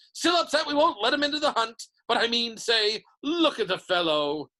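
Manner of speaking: loud, high mocking bluster; shouting. A narrator's reading. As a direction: jeer heartily at Nick before the crowd. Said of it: "shouted Sir Patrick's head".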